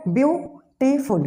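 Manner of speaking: ब्यूटीफुल in three slow, separate beats, byu... tee... ful; split into syllables. Shown as a demonstration. Teacher counting syllables aloud, ब्यूटीफुल broken as byu-tee-ful. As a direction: pause between each syllable of ब्यूटीफुल. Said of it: "कितने syllable आ रहा है".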